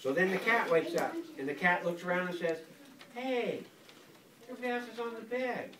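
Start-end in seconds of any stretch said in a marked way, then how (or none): 3.1-5.7 higher, fast lightly voiced cat; a quick bright-eyed cat noticing the bed; cat's voice. Narrating cat to child, higher and quick.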